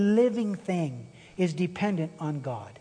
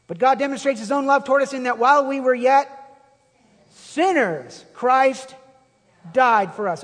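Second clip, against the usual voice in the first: full-force preaching; 5 semitones higher, 6 dB louder than usual. Acted.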